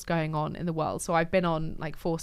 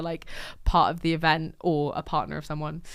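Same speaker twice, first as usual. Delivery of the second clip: usual speaking voice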